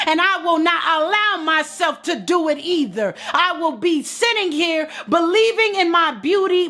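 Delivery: high, ringing preacher's cry; preaching at full force. Acted. Preacher urging Queen to claim her worth, full force. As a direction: preach it up to the rafters.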